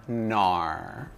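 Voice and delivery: low, drawn-out Aussie "nar"; Australian accent. Shown as a demonstration. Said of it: "N A U R".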